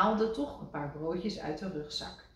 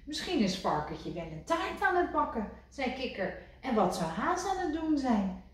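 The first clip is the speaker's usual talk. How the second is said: higher, wistful voice; wistful Kikker; 7 semitones higher than usual. A narrator's reading. Kikker to Rad, higher wistful. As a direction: dream of home.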